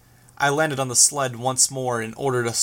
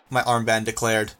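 plain reading voice

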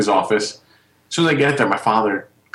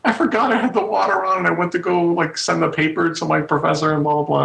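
imitating sister bawling; 7 semitones higher than usual. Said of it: "just bawling to me".

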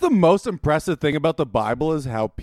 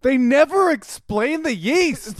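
exasperated; 13 semitones higher, 4 dB louder, much slower than usual. Real, not acted. Frustrated rant about yeast.

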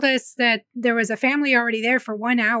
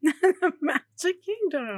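slow, higher, laughing MAGIC KINGDOM; drawn-out repeat; 6 semitones higher, much slower than usual. Real, not acted. Wife answers husband's "The where?", drawn-out and amused. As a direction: stretch out "Magic Kingdom", slowly and playfully.

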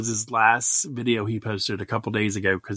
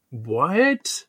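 mock puzzled what; 4 semitones higher than usual. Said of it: "they're going".

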